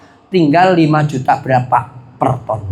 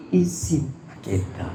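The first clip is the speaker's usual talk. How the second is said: ordinary delivery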